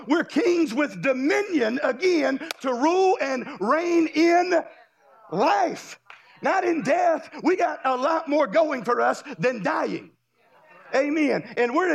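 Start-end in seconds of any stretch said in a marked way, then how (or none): 0.1-10.1 full-force preaching. Acted. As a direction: build to a triumphant cry. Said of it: "preaching at full force".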